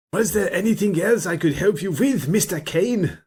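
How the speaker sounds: high, sing-song mock German accent; dodgy German accent. Hans asking Mister Kane a question, dodgy German accent. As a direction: ham up a high Indiana Jones-style German villain. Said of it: "the dodgy German accent".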